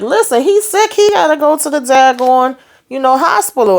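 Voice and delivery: put-on high, insistent mom pleading for her sick boy; protective mom. Mom refusing to let Ern near her sick son, high and firm.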